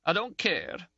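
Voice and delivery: high, tearful, mildly sobbing. A narrator's reading. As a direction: choke the words out through light tears. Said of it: "Ferguson sobbed".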